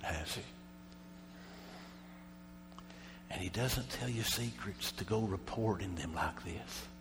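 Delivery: slow, soft preacher's delivery; hushed preaching. Real, not acted. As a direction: let it sink in slowly and softly.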